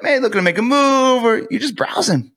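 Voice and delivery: high, light, curious question; breezy curious sales opener; curiosity tone. Shown as a demonstration. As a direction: float it up casually, just wondering. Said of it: "Curiosity tone".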